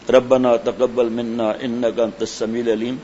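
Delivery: slow devotional prayer; prayer recitation. Acted. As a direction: recite the dua calmly and slowly.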